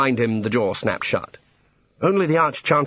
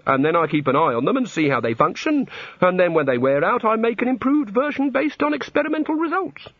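higher-pitched god, climbing as he explains; earnest god; 9 semitones higher than usual. A narrator's reading. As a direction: explain proudly, rising to a high pitch. The god explains his method.

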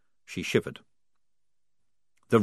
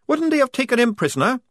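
higher, louder, doubtful; high questioning voice; 5 dB louder than usual. A narrator's reading. Tonka questioning Polly, high and doubtful. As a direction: ask it doubtfully.

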